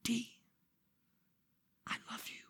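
very soft, intimate whisper; hushed, for one listener only. Shown as a demonstration. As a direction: whisper the name, pause, then confide. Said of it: "So that's why I'm whispering".

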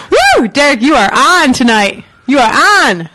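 high, bright hype call; excited announcer. Real, not acted. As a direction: pump up the room, cheering. Hyping up cohost on air.

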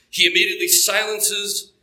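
ordinary delivery

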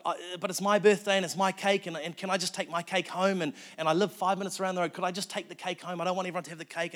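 high, loud, frantic pleading; freaking out. Retold or imitated. Agnes begging to keep her birthday cake, acted frantic high plea. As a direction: act out a woman pleading in a panic, stumbling over the words. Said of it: "Agnes starts freaking out".

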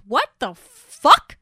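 loud, drawn-out disbelief; acted stunned exclamation; shocked outburst. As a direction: stretch out each word in loud, shocked disbelief. Voicing Alan's shock at Gene.

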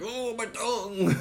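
high, stretched cry of pain; mock pained cry. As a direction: whine it like a burnt tongue. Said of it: "and they're like".

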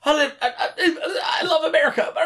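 very high wailing mock promo with a Puerto Rican accent; hammed-up tearful promo in a Puerto Rican accent; mock Puerto Rican accent wrestler promo; mock Savio Vega promo. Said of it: "where he's like".

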